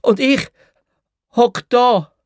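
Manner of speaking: hoher lauter Ruf; gerufen. Acted. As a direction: vom WC aus rufen. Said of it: "habe ich gerufen". (lauter hoher Ruf)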